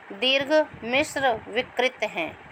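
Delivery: ordinary talk, nothing marked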